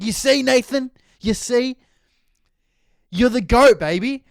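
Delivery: high, loud gloating cheer; triumphant cheer. Retold or imitated. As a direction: crow it at him like you won the bet.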